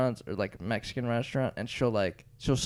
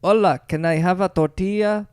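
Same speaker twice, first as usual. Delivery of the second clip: louder, higher hammed-up Spanish accent; mock Spanish accent; 7 semitones higher, 8 dB louder than usual. Mocking customers who fake Spanish at restaurants, mock Spanish accent. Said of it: "you're just doing a Spanish accent".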